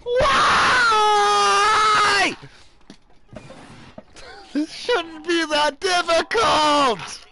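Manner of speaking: high, drawn-out frustrated scream. Real, not acted. Gamer finding it too difficult, high anguished screaming. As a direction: scream it out in exasperation. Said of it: "I'm sorry I'm screaming so much!".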